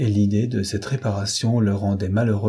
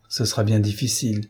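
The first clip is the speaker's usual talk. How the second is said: ordinary delivery, nothing marked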